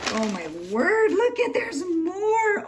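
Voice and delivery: rising into a high, drawn-out squeal; thrilled surprise. Real, not acted. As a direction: squeal at an unexpected extra treat. Discovers more items in gift.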